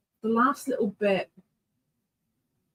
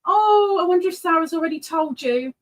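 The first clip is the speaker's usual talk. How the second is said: ordinary delivery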